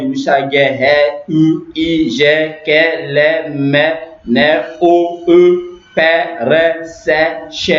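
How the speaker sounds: letters run together in quick succession; brisk recap of the letter sounds; rapid alphabet recitation. Shown as a demonstration. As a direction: rattle through the alphabet in short groups. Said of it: "on y va rapidement".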